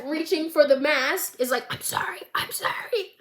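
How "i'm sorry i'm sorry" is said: breathless, high, whispered begging; gasping plea. Retold or imitated. Recapping Finn choking on the floor, gasping mock plea. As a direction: choke out the apology between gasps. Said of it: "Was that convincing?".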